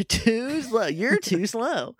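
high, slow mock Sonic catchphrase; Sonic taunt, misread. Podcaster echoing co-host's quip, high drawn-out Sonic taunt. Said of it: "he read Sonic lines wrong".

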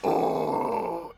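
rough, unpitched monster rumble; Onyx groan. Pretend. Game master as Onyx pulling out of the hole, groaning. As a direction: rumble as the big creature backs off.

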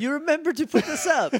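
high sing-song mock gush; mock sweet. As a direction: pitch it up and gush. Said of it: "echoing the gushing voice".

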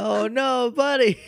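high-pitched pitying coo; cooing sympathy. Real, not acted. Listener feeling for poor crying Jiminy, cooing sympathy.

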